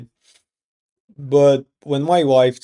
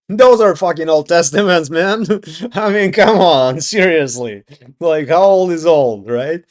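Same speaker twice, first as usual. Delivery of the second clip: loud, higher-pitched, laughing exasperation; exasperated outburst; 4 semitones higher, 6 dB louder than usual. Real, not acted. Arguing with believers in old testaments, loud and exasperated. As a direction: protest loudly at the absurdity.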